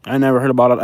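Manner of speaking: ordinary talk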